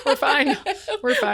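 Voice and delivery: high, loud, through laughter; laughing reassurance. Real, not acted. Hosts joking about their paused program, laughing it off. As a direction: brush it off while laughing.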